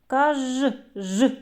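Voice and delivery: Каж then a lone ж, buzzing sound held apart; sounding out a syllable. Shown as a demonstration. Teacher isolates each sound of кажан for kids, drawn out. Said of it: "Твердий приголосний позначаємо однією рискою".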